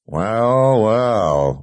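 drawn-out, leering cockiness; cocky. A narrator's reading. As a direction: say it through a cocky grin. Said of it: "said with a cocky grin".

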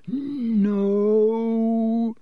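long, high, drawn-out cry; stretched panicked yell. A narrator's reading. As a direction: wail it out high in panic, holding the vowel. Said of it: "Ron yelled".

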